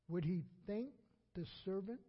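ordinary delivery

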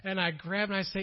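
usual speaking voice

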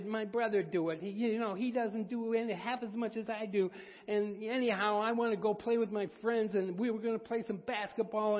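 put-on high, loud whining kid complaining about chores; whiny child. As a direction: whine like a sulky teenager. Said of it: "But whining, complaining".

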